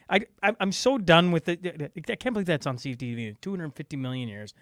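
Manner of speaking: ordinary talk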